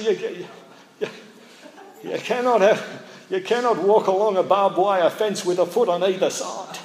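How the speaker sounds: high, loud mock Queensland politician voice, laughing through it; Joh Bjelke-Petersen impression. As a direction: imitate a folksy old politician laying down the law. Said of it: "he had a funny voice".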